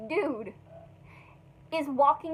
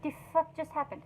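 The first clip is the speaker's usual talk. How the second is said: muttered aside; 9 dB quieter, faster than usual. Retold or imitated. Family muttering between each other after something stupid, under the breath. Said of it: "just talk in between each other".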